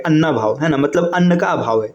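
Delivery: usual speaking voice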